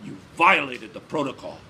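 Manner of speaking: loud, stern accusation; accusing. A narrator's reading. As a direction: press the charge hard. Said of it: "the giant accused".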